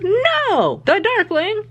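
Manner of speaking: high-pitched protest, several times; outraged no. Real, not acted. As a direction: squeal the no in disbelief. Outraged denial of the Darkling.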